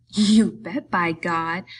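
loud burst of simple delight; gleeful, childlike exclamation; happy cry. A narrator's reading. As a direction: cry it out happily, starting loud. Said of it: "he cried happily".

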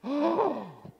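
big high theatrical gasp; shocked gasp. Acted. Storyteller reacts to the duck being killed, shocked gasp. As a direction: gasp in mock horror.

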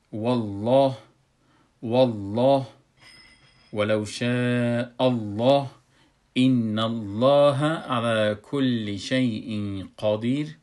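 slow, loud recitation with thick L in الله; drawn-out Quranic phrases, dark "Alloh" and heavy qaf; measured tajweed reading, held long; full, heavy recitation. Shown as a demonstration. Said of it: "حروفی را که ما رنگش سرخ کردیم".

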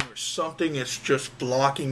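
talking around mouth guard; speaking while removing mouthguard. Real, not acted. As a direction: hand over mouth, guard out. Seen 0: hand at mouth pulling guard out; then held beside face.

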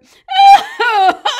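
hammed-up high wailing sobs; loud sobbing. Storyteller voices the bear in the woods, loud sobbing. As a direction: bawl loudly in mock misery. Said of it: "the sound of sobbing".

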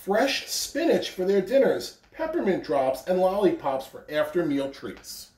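gentle storybook reading; reading to children. A narrator's reading. Principal reading The Little Engine That Could to children, gentle. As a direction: read it like a picture book to kids. Reading a picture book aloud.

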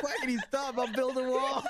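high, loud wail through laughter; laughing hard. Real, not acted. Gamer quoting friend's plea to stop, helplessly laughing. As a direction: quote it while falling apart laughing. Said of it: "why is he laughing so much".

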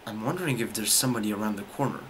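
low, softened, cautious voice; wary hush. Real, not acted. Gamer wary of somebody around the corner, hushed. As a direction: speak low, as if someone could hear.

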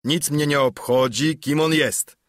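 dismissive loud call; Silver calling out. A narrator's reading. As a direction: call it out across the room. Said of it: "zawołał Silver".

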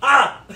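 sudden loud "Ah!" to scare; startling boo. Acted. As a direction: jump out with a loud ah. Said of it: "Did I scare ya?".

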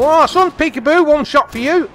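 excited taunt. Real, not acted. Catches enemy, gets kill.

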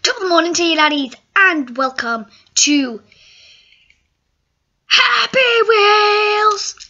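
mock Irish accent, show title belted high; badly faked Irish greeting building to a long, high shout; Jacksepticeye. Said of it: "Irish accent".

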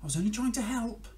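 lightly voiced dog, high and a little wounded; a hurt dog sticking up for itself. Dog answers frog and cat, lightly hurt.

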